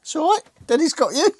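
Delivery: high, gentle reassurance. Real, not acted. Dad reassures scared child on bike.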